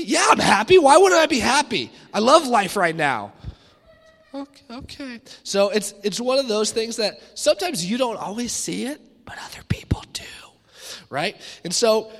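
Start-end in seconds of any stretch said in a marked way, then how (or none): 0.0-3.3 loud, much higher irritated snapping; hangry outburst. Retold or imitated. Hangry husband insists he's happy. 4.3-5.2 soft, higher, cautious okay; timid backing off. Retold or imitated. Wife backs off quietly. 9.2-10.2 hushed secretive whisper; whispered aside. Real, not acted.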